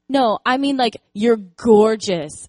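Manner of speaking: put-on a child pressing the point, slow and emphatic; little girl insisting. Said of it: "she goes".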